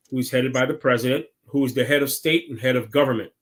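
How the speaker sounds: usual speaking voice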